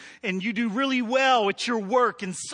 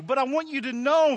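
usual speaking voice

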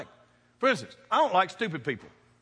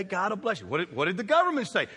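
ordinary delivery

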